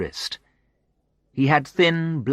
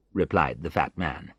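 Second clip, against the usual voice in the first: plain reading voice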